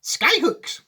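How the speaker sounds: high, emphatic exclamation; Mister Wonka. Acted. Wonka answering the doubting grandparents, high and emphatic. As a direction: announce it grandly, pitched well up. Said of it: "said Mister Wonka".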